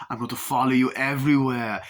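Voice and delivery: a creepy admirer promises; hammed-up stalker voice.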